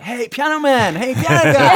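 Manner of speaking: high, loud greeting called across a room; passengers calling out. Retold or imitated. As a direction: imitate fans hailing someone they recognise. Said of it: "and people like".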